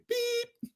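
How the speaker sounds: a tiny, very high soft beep; imitating the tone at the end of a voicemail; mock voicemail beep. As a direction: chirp it like an answering machine. Mock voicemail greeting ends.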